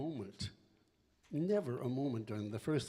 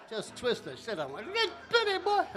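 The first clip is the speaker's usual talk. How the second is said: cheek-twisting grandma; 12 semitones higher, 7 dB louder than usual. Retold or imitated. Aftermath of grandma's voice.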